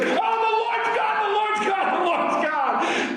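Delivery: high, drawn-out crowd cry, repeated like a chant; preacher acting out a crowd's fervent outcry; awestruck acclamation. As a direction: cry it out as an awed crowd, slowing at the end. Crowd falls down crying out.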